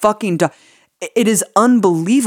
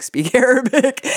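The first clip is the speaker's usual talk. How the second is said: laughing; 10 semitones higher than usual. Real, not acted. Amused at the faked recording.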